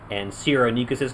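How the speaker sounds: normal talking voice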